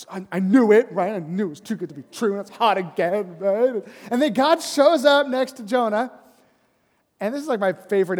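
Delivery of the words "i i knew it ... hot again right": mock exasperated whine. Jonah whining about the worm.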